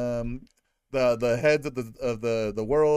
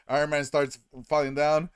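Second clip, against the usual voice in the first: ordinary talk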